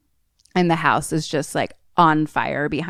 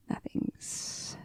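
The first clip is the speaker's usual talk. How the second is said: whisper; 10 dB quieter than usual. Retold or imitated. Fitch whispering in tense silence.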